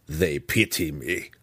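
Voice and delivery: slow, understated snarl; mildly bitter, drawn-out snarl. A narrator's reading. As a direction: snarl it slowly in a character voice. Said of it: "Belwar snarled".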